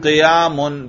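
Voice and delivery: "قیامن" ending clearly in -un; raf' ending. Shown as a demonstration. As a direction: say "قیامن" with a final "un" sound. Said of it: "رفع پڑھ کے دکھاؤ". ("قیامن" رفع کے ساتھ، آخر میں "اُن")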